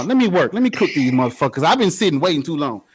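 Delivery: normal talking voice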